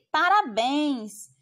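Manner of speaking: slow, emphatic "Parabéns!"; exclamation. Shown as a demonstration. Teacher shows the exclamatory tone to the class. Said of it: "A entonação é uma entonação mais o quê?".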